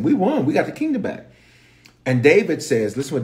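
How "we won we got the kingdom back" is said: high, excited mock victory cry; rejoicing. The men return to David victorious, rejoicing. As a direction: celebrate like triumphant soldiers. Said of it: "the men are rejoicing".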